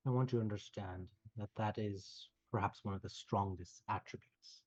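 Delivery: calm kindly mentor, reassuring; gentle wise healer. Acted. NPC speaks gently, removing curse.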